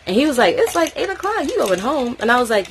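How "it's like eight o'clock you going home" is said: mock surprised. Voicing the guy, surprised.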